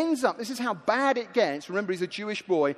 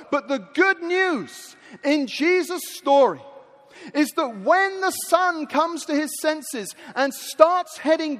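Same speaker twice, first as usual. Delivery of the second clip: raised, drawn-out sermon storytelling; preaching; 5 semitones higher, 4 dB louder than usual. Real, not acted. Preacher tells of the father spotting his returning son, rousing preaching. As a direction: build with emphatic pauses to a loud, stretched finish.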